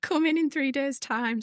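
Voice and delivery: normal talking voice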